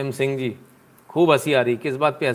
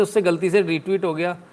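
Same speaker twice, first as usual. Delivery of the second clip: usual speaking voice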